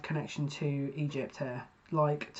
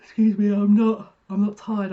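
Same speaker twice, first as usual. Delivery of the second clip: loud, higher-pitched objection; indignant protest; 4 semitones higher, 11 dB louder than usual. Real, not acted. Reader answering viewers who think she's tired, indignant protest. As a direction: burst out in protest, then ease off slightly.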